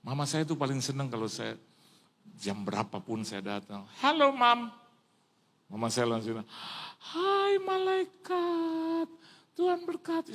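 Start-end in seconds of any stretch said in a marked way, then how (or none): 4.0-4.7 high, bright call to mother; acted happy hail; cheery greeting. Greets his mother on arrival. 7.0-10.3 very high, drawn-out greeting; high-pitched mock motherly welcome; imitated delighted mother.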